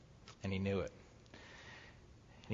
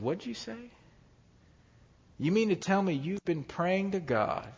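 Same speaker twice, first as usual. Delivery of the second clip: surprised father; 8 semitones higher than usual. Retold or imitated. Father hears son prayed.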